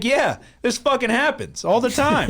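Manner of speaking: high, drawn-out fed-up complaint; retold exasperation. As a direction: re-enact a long-suffering fan. Retells exasperated reply to friends.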